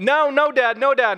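a son protesting to his dad; whiny child denial. Retold or imitated. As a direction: plead innocent like a kid. Son denies biting.

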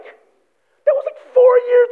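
incredulous protest. Retold or imitated. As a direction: protest it squeaky and outraged. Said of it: "I'm like".

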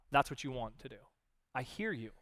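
usual speaking voice

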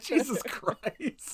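high, drawn-out mock shock; stunned exclamation. Podcaster recalling the robot's beatdown, high stunned exclamation. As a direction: stretch it out way up high, aghast.

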